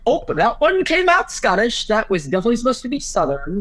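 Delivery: high-pitched comic oops; mock dismay. Actor recalling an accent slip on stage, mock dismay.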